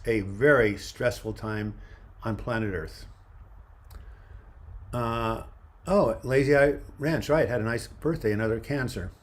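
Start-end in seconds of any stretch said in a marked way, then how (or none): none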